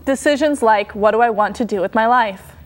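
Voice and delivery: normal talking voice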